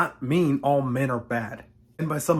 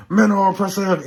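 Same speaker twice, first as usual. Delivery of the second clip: high, loud bullhorn shout; bullhorn yell; 7 semitones higher, 11 dB louder than usual. Retold or imitated. Blue-haired feminist yelling at marchers through bullhorn, loud. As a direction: blast it like into a megaphone. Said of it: "into a fucking bullhorn".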